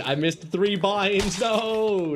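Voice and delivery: drawn-out, high-pitched wail of defeat; loud lament. Real, not acted. Gamer lamenting missed binds after losing the duel, drawn-out wail. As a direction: stretch it out high in dismay.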